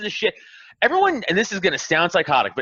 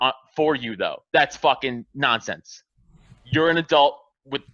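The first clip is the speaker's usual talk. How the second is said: ordinary talk, nothing marked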